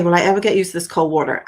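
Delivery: usual speaking voice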